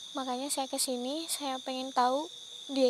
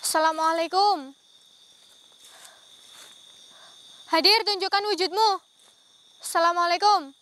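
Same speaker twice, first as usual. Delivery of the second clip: loud, higher command to appear; commanding call; 3 semitones higher, 8 dB louder than usual. Real, not acted. Ghost hunter commanding the spirit, loud. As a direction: order the spirit to show itself.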